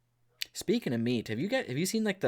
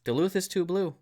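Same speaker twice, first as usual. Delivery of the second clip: "Duluth is too blue" restated at a higher pitch; repeating to be heard; 6 semitones higher than usual. Real, not acted. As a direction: say "Duluth is too blue" over, higher, for someone who missed it. Repeats it after a "Huh?.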